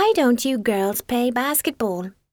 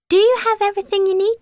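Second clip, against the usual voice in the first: ordinary delivery, nothing marked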